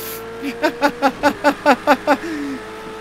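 long high-pitched laughing fit; real laugh. Real, not acted. Player cracking up at own setback, high laughter. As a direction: keep laughing helplessly, high.

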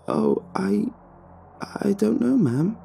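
high, halting, embarrassed stammer; flustered. A narrator's reading. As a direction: flush and trip over the words. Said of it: "I flushed".

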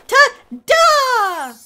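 big, loud, high drawn-out ta-da; playful squealed fanfare; triumphant reveal. Pretend. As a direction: present it with a grand flourish. Repeats the reveal for Deli.

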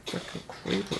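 hushed whisper cursing the creeper. Real, not acted. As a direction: mutter it under your breath, unvoiced. Hiding from a creeper.

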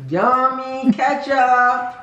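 slow, high, dripping sarcasm; sarcastic. Real, not acted. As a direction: say it like it was delicious, not meaning it. After forcing down ketchup.

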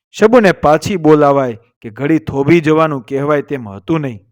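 ordinary delivery, nothing marked

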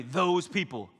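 sneering repeat. Shown as a demonstration. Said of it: "Everybody say".

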